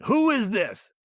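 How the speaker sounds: high mock voice, demanding; gruff landlord. Landlord demanding who is calling him late, high mock voice. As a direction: pitch way up, suspicious question.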